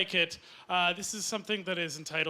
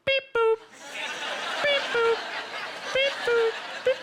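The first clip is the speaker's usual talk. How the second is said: imitated crossing beeps. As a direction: mimic a crosswalk signal in high, short beeps. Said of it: "nuzzling up behind their ear and saying".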